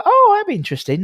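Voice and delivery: light high-pitched impression of a curious guest; mock intrigued guest. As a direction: play a guest going oh, that'd be interesting. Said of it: "They're, they're like".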